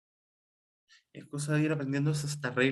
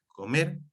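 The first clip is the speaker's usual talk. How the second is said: coMER with the E stressed and rising; stress on final syllable; 2 semitones higher than usual. Shown as a demonstration. Teacher showing students where the accent falls, stressed final E in "comer". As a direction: lean on the second syllable of "comer". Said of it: "Marco el acento en la E".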